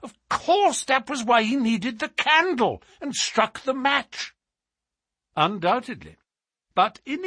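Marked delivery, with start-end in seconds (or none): 0.0-4.3 high-pitched burst of realization; excited cry. A narrator's reading. Colonel works out the candle and match, high excited exclamation. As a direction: exclaim it high, as it all falls into place. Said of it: "cried the Colonel".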